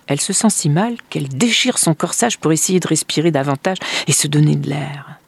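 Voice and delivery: ordinary delivery, nothing marked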